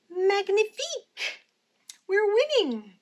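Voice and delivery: high, loud thrilled cry; excited cheer. A narrator's reading. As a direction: burst out with joy. Excited about leading.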